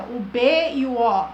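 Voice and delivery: ordinary delivery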